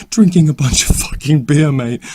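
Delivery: laughing through the words, loud and high; can't hold the laugh while mocking him. Inmate laughing while mocking the beer drinker. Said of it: "Sorry, I'm trying not to laugh".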